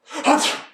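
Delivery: big fake sneeze; loud sneeze. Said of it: "he said with a sneeze".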